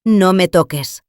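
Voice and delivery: mother snapping at her grabbing son in public. Acted. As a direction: push him back with your voice. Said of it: "por primera vez alcé la voz".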